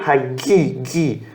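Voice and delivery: "gi" with a hard G, as in "gu"; aspirated "ha", then a hard "gi"; hard G sound. Shown as a demonstration. Teacher breaking the word down for students, hard G in "gi". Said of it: "como um som de gu".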